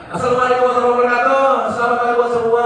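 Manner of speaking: a showy emcee welcoming the crowd; lively entertainment MC. Shown as a demonstration. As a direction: fire up the crowd like a party host. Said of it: "itu M C".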